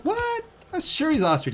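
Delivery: shocked disbelief. Retold or imitated. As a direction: play up the surprise. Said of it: "I'm like".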